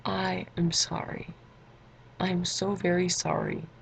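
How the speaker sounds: slow, deliberate mock-formal apology; official apology. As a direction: apologize very slowly, word by word, for show. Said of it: "she wants an official apology".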